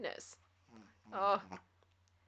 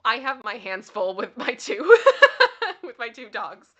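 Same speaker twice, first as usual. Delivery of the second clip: laughing; 13 dB louder than usual. Real, not acted. Laughing at her dogs.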